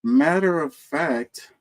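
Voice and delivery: ordinary talk, nothing marked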